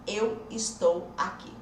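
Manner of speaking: "Eu estou aqui" slowly, each word separated; slow, spaced dictation of "Eu estou aqui". Shown as a demonstration. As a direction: spell out "Eu estou aqui" one word at a time. Repeats sentence word by word.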